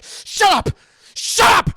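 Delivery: explosive high, loud shout; acted furious outburst; angry Howard. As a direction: blow up and yell to silence him. Acted host snaps at chatterbox.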